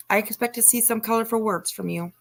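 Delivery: usual speaking voice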